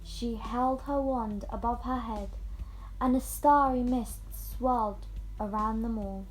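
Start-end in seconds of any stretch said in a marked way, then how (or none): none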